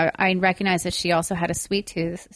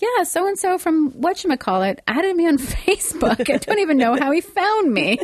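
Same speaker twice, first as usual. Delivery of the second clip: puzzled woman retelling; 9 semitones higher than usual. Retold or imitated. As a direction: play a baffled young woman who got a stranger's friend request. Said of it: "people be like".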